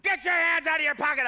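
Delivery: imitating a rough, loud shout; Mahesh Shavda. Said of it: "he's screaming at me".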